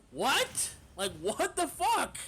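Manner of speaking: high, slow incredulous what; stunned, drawn out; mock disbelief at the praise; retold shock.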